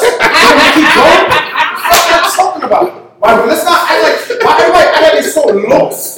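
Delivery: loud, laughing taunt; laughing challenge. Real, not acted. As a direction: laugh through the challenge, pushing back. Laughing while challenging friend.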